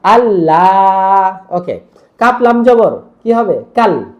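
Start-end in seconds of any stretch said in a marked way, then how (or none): 0.0-1.4 আল্লাহ with quick আল and long লা; আল্লাহ, final vowel stretched very long; quick first, long second. Shown as a demonstration. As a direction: hurry the আল, then hold লা on one note. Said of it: "আল, তাড়াতাড়ি লাতে টান দিলাম".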